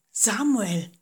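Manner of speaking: a hint of a distant, otherworldly voice calling; lightly voiced voice from afar; voiced mysterious call. A narrator's reading. A mysterious voice calls at night.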